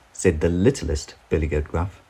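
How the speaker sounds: ordinary delivery, nothing marked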